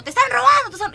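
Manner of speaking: high, loud mock alarm cry; retold shouting. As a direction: imitate a brother yelling a warning. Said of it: "cómo le estaban robando y gritó".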